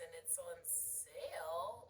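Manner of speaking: SALE drawn out and rising; slow down and make "sale" go up at the end; rising inflection. Shown as a demonstration. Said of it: "if you make it go up".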